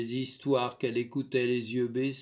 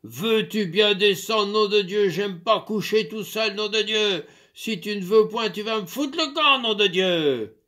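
loud, high furious bellowing; raging; 8 semitones higher, 6 dB louder than usual. Acted. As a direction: roar your demands. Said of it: "il vociféra".

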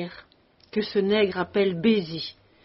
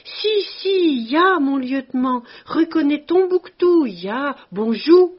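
much higher and louder, delighted bellow; bellowing with joy; 5 semitones higher, 7 dB louder than usual. A narrator's reading. Tombouctou answering the officer with a loud, high, joyful bellow. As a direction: roar it out, thrilled to be recognized. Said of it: "en poussant un rire ... beuglant".

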